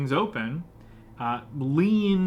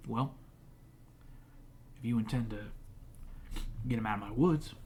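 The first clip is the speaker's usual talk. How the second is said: a hint of a gruff woodsman; gruff hermit; 2 semitones lower, 12 dB quieter than usual.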